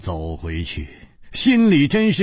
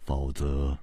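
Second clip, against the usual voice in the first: faintly amused, detached; 7 semitones lower than usual. A narrator's reading. Ji Bingyan explaining the king's motive, low detached calm. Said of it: "姬冰燕淡淡一笑说".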